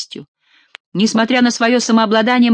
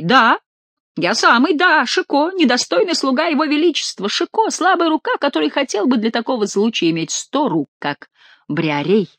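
higher, gleeful self-introduction; triumphant reveal; 6 semitones higher than usual. Acted. Chicot revealing himself to the duke, gleeful. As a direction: savor revealing yourself.

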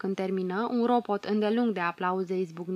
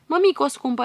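louder, higher crying call; desperate wailing call; sobbing plea; 5 semitones higher, 6 dB louder than usual. Acted. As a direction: cry out to a mother leaving. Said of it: "plângând cu hohote".

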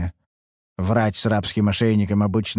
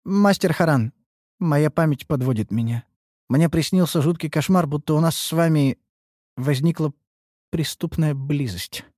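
halting, high-pitched hesitation; awkward, broken-up confession; struggling for words; 6 semitones higher than usual. A narrator's reading. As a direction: pick each phrase with difficulty, pausing between. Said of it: "с трудом подбирая слова".

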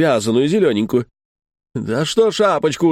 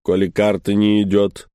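weary doorman; 4 semitones lower than usual. Retold or imitated. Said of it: "говорит".

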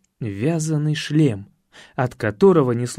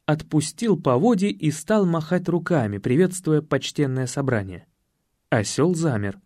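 ordinary delivery

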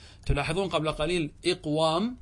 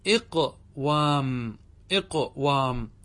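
slow demonstration; much slower than usual. Shown as a demonstration. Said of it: "teacher slowly sounds out the form".

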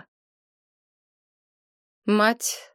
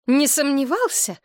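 higher, sharp disbelief; incredulous exclamation; 8 semitones higher than usual. A narrator's reading. Galina exclaiming at the doctor's certainty, incredulous. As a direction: exclaim in doubt. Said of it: "воскликнула Галина".

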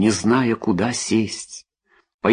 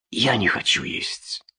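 hopeless; 5 dB quieter than usual. A narrator's reading. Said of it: "подумала Трейси безнадёжно".

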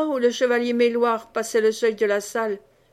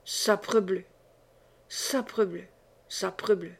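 low-key, softer grumbling under the breath; grumbled oath; 3 semitones lower, 6 dB quieter than usual. A narrator's reading. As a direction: grumble the oath to yourself, troubled. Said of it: "grommela-t-il par trois fois". (juron grommelé à voix basse)

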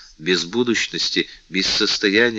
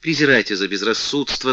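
ordinary talk

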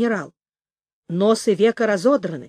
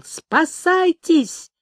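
shouted alarm; 7 semitones higher, much slower than usual. A narrator's reading. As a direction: call out a stretched warning, pitch raised. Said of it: "крикнул Дрёма и забарабанил". (высокий протяжный крик тревоги)

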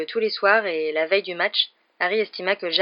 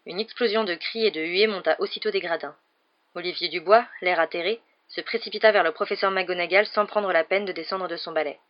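plain reading voice

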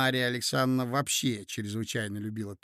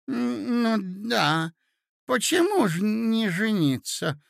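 put-on high, hesitant old prince; befuddled agreement; 7 semitones higher, 4 dB louder than usual. A narrator's reading. Prince echoes the ladies' urging, befuddled and agreeing. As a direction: go along with it, high and confused. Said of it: "поддакивал князь, сбитый с толку".

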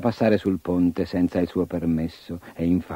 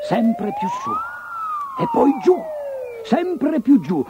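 rising and falling voice. A narrator's reading. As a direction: voice soaring high then down. Orc flies up and down.

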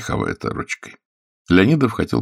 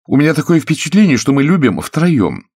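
complaining actress; 10 semitones higher, 6 dB louder than usual. A narrator's reading. Actress complains to her lover of a threesome, aggrieved.

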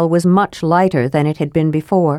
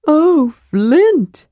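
much higher gasp of wonder; kender awe; 9 semitones higher, 3 dB louder than usual. Acted. Kender to dwarf on battlements, much higher wonder. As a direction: marvel at the sight. Narrator voices Tass.

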